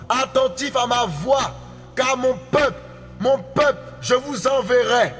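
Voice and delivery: ordinary talk, nothing marked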